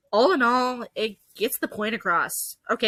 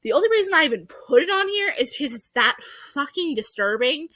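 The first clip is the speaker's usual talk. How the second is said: ordinary talk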